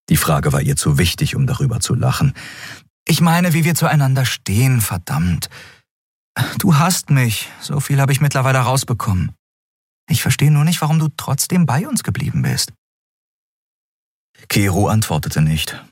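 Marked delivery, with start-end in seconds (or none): 3.1-12.7 higher, hesitant question; Endriel, higher voice. A narrator's reading. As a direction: ask carefully, in a higher voice. Endriel pressing Kero. (Endriel fragt Kero zögernd, höhere Stimme)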